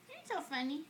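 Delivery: normal talking voice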